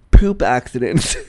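breaking into a giggle on "poop accidents"; slow down on "poop accidents", then crack up; giggling at poop. Real, not acted. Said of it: "I'm laughing at the word poop".

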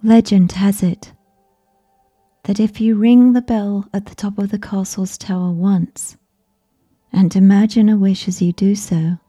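usual speaking voice

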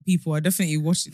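ordinary talk

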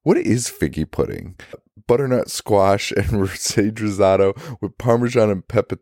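ordinary talk, nothing marked

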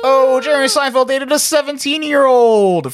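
high, drawn-out cry of revelation; exaggerated high wail. Real, not acted. Co-host breaking scandal to friends, high incredulous wail. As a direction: wail it out on one long high note.

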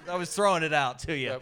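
usual speaking voice

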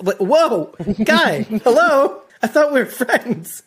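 high, slow, hurt disbelief; mock offended. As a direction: play it wounded and baffled. Mock hurt reaction to Percy.

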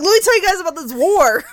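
loud, high mock enthusiasm with laughter; overeager history buff. Documentary fan corners family about the war, mock eagerness. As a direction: proclaim it loudly as a self-parody. Parodies self as documentary bore.

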